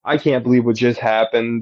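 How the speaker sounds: ordinary delivery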